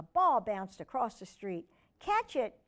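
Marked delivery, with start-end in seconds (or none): 2.0-2.6 put-on high girls' call. A narrator's reading. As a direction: call across the street like kids. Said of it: "Chung and Amy called out".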